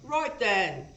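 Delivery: a hammed-up British "Right then" with a falling end; terrible British accent. A reactor hamming up a British "Right then" for a British singer. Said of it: "I do terrible British accent".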